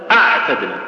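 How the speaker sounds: "أعتدنا" with the ع closed completely, the sound stopped; ain fully choked off. Shown as a demonstration. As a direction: cut the voice dead on the ع of "أعتدنا". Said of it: "لو بتحبس الصوت فيها بتقول".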